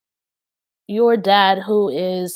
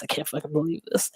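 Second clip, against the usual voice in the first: whispered aside; 3 semitones lower, 10 dB quieter, much faster than usual. Real, not acted. Aside while channeling.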